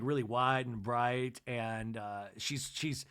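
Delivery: ordinary talk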